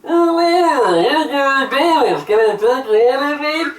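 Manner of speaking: high, loud garbled speaker voice; drive thru speaker. Pretend. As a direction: squawk through a crackly speaker. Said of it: "terrible drive-through speakers".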